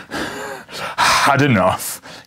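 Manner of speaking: slow, breathless booming gasp; panting. Acted. Challenger panting after the fight, slow and breathless. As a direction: drag it out between heavy breaths. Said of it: "he panted".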